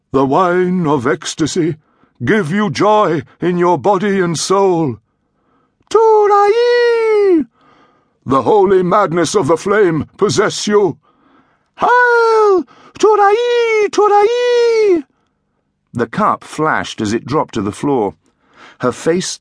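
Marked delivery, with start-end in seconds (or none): 0.1-5.0 high, slow, exalted priest intoning the rite; ecstatic high priest. A narrator's reading. Priest blesses the woman. 5.9-7.5 very high, loud cry of Turai; ecstatic shout. A narrator's reading. 8.2-11.0 ecstatic high priest. A narrator's reading. Priest blesses the woman. 11.8-15.0 very high, loud cry of Turai; ecstatic shout. A narrator's reading. Woman's frenzied chant.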